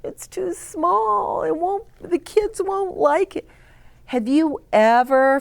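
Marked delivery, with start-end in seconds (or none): 0.0-3.5 higher, fretting costume-maker voice; worried Brock. Retold or imitated. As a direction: pitch up and fret over the costume. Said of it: "he was worried about his costume".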